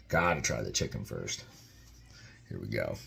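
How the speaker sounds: "Gotta try the chicken first" murmured low and quiet; muttered to himself. Real, not acted. Reviewer murmuring to himself before tasting, quiet. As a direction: repeat it under your breath. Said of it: "I gotta try the chicken first".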